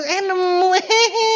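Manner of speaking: high, hammed-up surprise breaking into a giggle; silly finder giggling at his luck; mock surprise with giggle. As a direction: squeal then giggle at the lucky find. Mocking a lucky lamp find.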